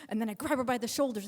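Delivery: plain reading voice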